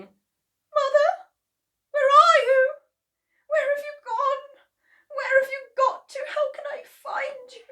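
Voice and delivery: high, tearful calling for a vanished mother; lost, searching daughter. Acted. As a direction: call out high and plaintive. Missus Cranky searching, puzzled.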